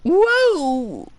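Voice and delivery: high, loud whoa; excited whoa. Retold or imitated. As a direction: shout whoa like the game voice. Echoing the game's exclamation.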